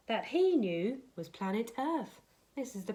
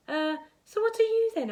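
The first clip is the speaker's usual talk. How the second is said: put-on high boy voice; 11 semitones higher, 6 dB louder than usual. Charlie asks the creature.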